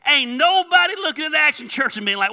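high, loud, shouted sermon; full-force preaching. Acted. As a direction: preach it at a shout, driving and insistent.

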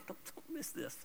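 ordinary delivery

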